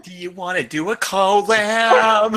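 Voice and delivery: slow British YouTuber voice; drawn-out British YouTuber line; British accent. Acted. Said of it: "The way you".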